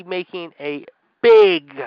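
"big" drawn out slowly and punched; stress on big. Real, not acted. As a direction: hold and lean hard on the word "big". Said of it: "I emphasize the word big".

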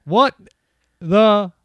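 high, loud, word-by-word astonishment; loud disbelief. Real, not acted. Reacting to a plagiarised movie quote, loudly incredulous. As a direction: punch each word out in real disbelief.

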